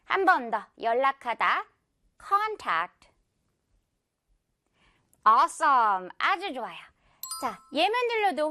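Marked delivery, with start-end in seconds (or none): 2.3-3.1 contact starting high, then dropping; stress on CON. Shown as a demonstration. Teacher gives one more model, front-stressed contact. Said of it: "앞부분의 강세".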